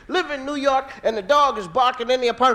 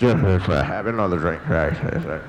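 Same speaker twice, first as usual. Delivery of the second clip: much lower, slow, louder hermit voice; old hermit; 13 semitones lower, 4 dB louder, much slower than usual. Retold or imitated. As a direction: offer the drink like a boozy old recluse. Old hermit offering wine.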